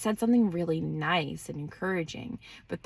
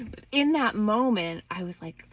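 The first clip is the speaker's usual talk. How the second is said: usual speaking voice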